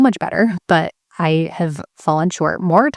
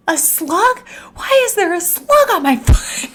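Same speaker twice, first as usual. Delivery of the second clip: much higher, half crying out in horror; disgusted yell. Retold or imitated. Mom finding a slug on her foot, squealing in horror. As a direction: squeal at the slug with laughter breaking in. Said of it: "I'm yelling at this point".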